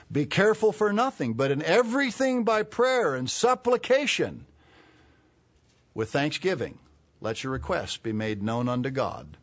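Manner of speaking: high, loud, ringing Bible reading; scripture reading. Real, not acted. Preacher reads Philippians to the congregation, forceful scripture reading. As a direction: read the verse out high and strong.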